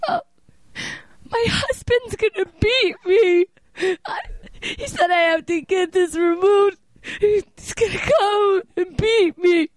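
high, slow, wailing sob; badly faked desperate weeping for sympathy; fake crying. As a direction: drag the words out through put-on tears. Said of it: "because I start doing this".

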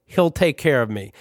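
usual speaking voice

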